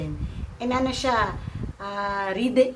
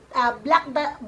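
ordinary talk, nothing marked

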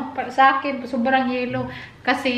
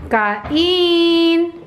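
calling out; 6 semitones higher, 4 dB louder than usual. Real, not acted. Calling everyone to eat.

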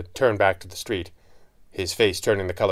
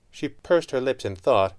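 ordinary delivery

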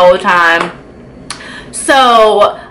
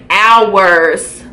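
normal talking voice

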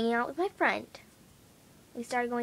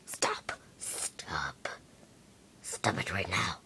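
fading stop; 8 dB quieter, much slower than usual. Acted. Scolding laughing viewers, fading.

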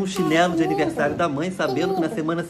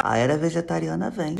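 low, softer, ominous aside; intrusive inner aside; 6 semitones lower, 6 dB quieter than usual. Acted. Meditator distracted by meat prices, low foreboding aside. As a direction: drop it low like a dawning prophecy.

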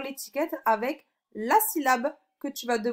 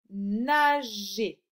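long, held syllables; slow dictation; 3 dB louder than usual. Shown as a demonstration. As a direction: stretch both syllables for the pupil. Teacher dictating words for sound exercise. (mot dicté lentement)

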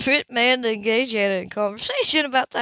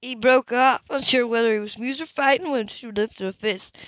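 usual speaking voice

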